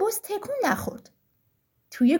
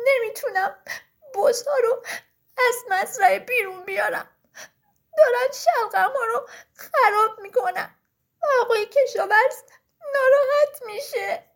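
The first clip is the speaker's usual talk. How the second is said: hammed-up sobbing, higher and broken up; acted crying; 5 semitones higher, 4 dB louder than usual. A narrator's reading. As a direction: wail it haltingly as a tearful little shepherd. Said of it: "بلند گریه کرد".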